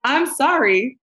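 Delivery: high, giggly retelling; cracking up mid-sentence; laughing hard. Real, not acted. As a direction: fight through the laughter to quote yourself. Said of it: "I'm laughing so hard right now".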